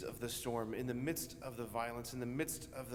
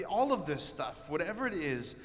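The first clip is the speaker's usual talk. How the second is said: usual speaking voice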